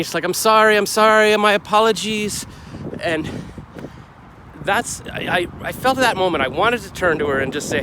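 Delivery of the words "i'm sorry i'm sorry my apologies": loud, high apology called after someone; calling out apologies. Retold or imitated. The woman calling after him as he walks away, loud apology. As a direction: shout the sorry after him as he leaves. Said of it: "the woman's yelling behind me".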